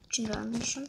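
early-morning hushed whisper; whispering on the phone. Real, not acted. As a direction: whisper so nobody wakes up. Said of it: "почему я говорю шёпотом".

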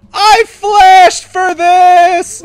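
very slow, high, full-force wail; anguished drawn-out scream. Real, not acted. Gamer losing the kill he flashed for, stretched scream. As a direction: stretch every word into a desperate scream. Repeats protest, far more drawn out.

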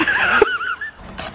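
bursting out in a high laugh. Real, not acted. Laughing at the racist joke.

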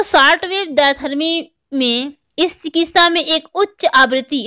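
normal talking voice

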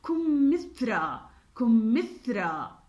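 كمثرى spaced out slowly, th clear; slow demo word. Shown as a demonstration. Teacher saying كمثرى slowly for the class. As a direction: stress the middle ث in كمثرى. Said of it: "كلمة".

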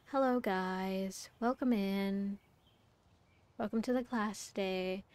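soft, slow, calming teacher voice; mellow. Retold or imitated. Biology teacher welcoming the class, mellow. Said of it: "She had, like, a very mellow voice".

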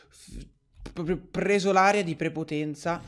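ordinary talk, nothing marked

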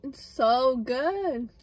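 freshly swallowed drink; holding cold drink, talking; right after a sip. Real, not acted. Seen 0: Sipped through straw at -3 to -2s; now talking, straw out.

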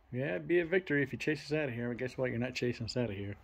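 normal talking voice

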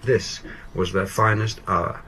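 slow reading that settles down to "hour"; natural falling pitch. Shown as a demonstration. Presenter reading the end of a paragraph, pitch falling naturally to "hour". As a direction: let the pitch fall gently through to "hour". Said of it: "you fall in pitch very naturally".

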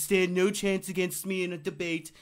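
lightly imitating a boastful tweet; mock president reading his own tweet; mock Trump tweet. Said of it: "stop tweeting Jon Stewart".

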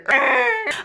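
high, loud burst of laughing; helpless squealing laugh after the imitation; real laughter. Real, not acted. Recapper cracking up at her retold refusal, laughing hard. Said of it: "I died of laughter".

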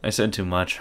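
ordinary talk, nothing marked

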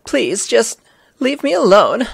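high, slow, drawn-out plea; hammed-up high woman's voice; pleading woman. Survivor begging the reporter to leave her alone, high and pleading. Voicing harassed woman from game.

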